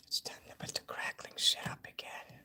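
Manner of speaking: soft unvoiced whisper; whispering to herself in the dark. Real, not acted. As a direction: hush it right down to a whisper. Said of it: "Why are you whispering?".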